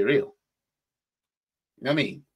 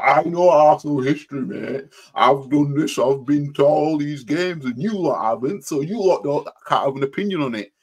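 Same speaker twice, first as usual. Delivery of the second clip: higher and louder, puffed-up know-it-all; mocking a boastful fan; 6 semitones higher, 7 dB louder than usual. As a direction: lord it over them like a smug old-timer. Mocking rival's boastful talk.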